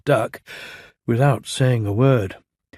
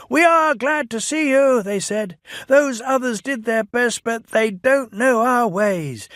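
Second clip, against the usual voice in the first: welcoming cheer; 11 semitones higher, 3 dB louder than usual. A narrator's reading. People cheering engines home.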